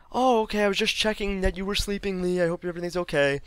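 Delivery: high, airy cover story; high-pitched put-on motherly voice; imitated mom caught out. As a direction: play an innocent mom checking on her child.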